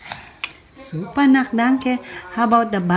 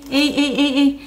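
usual speaking voice

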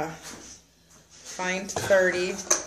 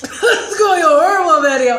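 laughing; 9 semitones higher, 9 dB louder than usual. Real, not acted. Laughing while in spicy pain.

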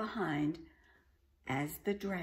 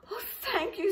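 put-on higher grateful young dragon; relieved baby dragon; 4 dB louder than usual. A narrator's reading. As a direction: sigh out thanks as the dragon. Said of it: "said the baby dragon".

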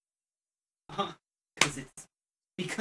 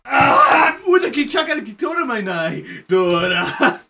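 fake Japanese; 6 semitones higher, 3 dB louder, slower than usual. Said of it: "why do you know fluent Japanese".

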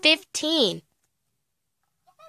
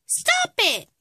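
sharp, high-pitched scolding cry; high, exasperated command; 12 semitones higher than usual. Acted. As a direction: pitch jumps high, then drops, to halt the bickering. Mother breaks up the bickering.

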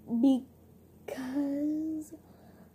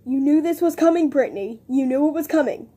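loud playful call-out; louder jokey taunt; loud teasing; 9 dB louder than usual. Real, not acted. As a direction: shout it at Brittany, laughing. Teasing friend loudly.

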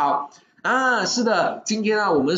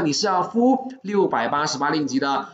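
ordinary talk

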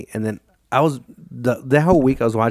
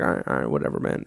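low, softer brush-off; resigned inner voice; 11 semitones lower, 5 dB quieter than usual. Retold or imitated. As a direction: give in under your breath, pitched low. Resigned inner reply to police.

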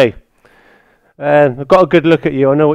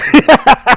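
burst of laughter; 9 semitones higher, 4 dB louder than usual. Real, not acted. Reacting to knocked out friend.

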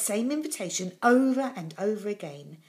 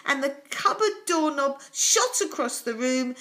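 high, loud, breathless storytelling; read-aloud drama of the big sneeze; building excitement; 10 semitones higher, 4 dB louder, slower than usual. A narrator's reading. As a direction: race through the chaos.

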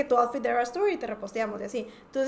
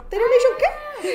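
much higher, slow, laughing disbelief; shocked disbelief; 11 semitones higher, 5 dB louder, slower than usual. Retold or imitated. Reliving seeing the Taylor Nation repost, laughing disbelief.

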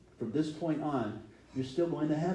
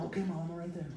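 put-on soft, higher child answering mom; meek kid reply; 5 semitones higher, 6 dB quieter, faster than usual. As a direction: answer mom sheepishly, softly. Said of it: "I'm gonna get the chunky line".

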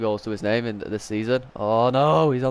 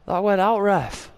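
indignant; 9 semitones higher, 3 dB louder than usual. Real, not acted. Disputing call with referee.

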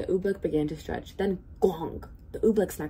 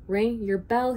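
raised, louder call; 5 semitones higher, 5 dB louder, slower than usual. A narrator's reading. Bartholomew rousing the bell ringer, calling out. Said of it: "he called".

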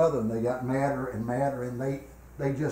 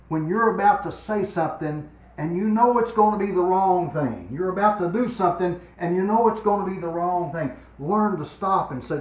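loud, high preaching, pressing the point home; preaching at full force; 6 semitones higher, 6 dB louder than usual. Acted. Preacher warning the church about wrong words, preaching at full force. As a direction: drive it at the congregation like a revival preacher.

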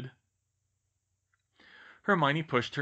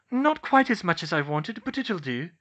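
high, raised character voice; Hermione's voice; 7 semitones higher, 6 dB louder than usual. A narrator's reading. Hermione answers George about the tungsten, high Hermione voice. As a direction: answer higher and louder, in character.

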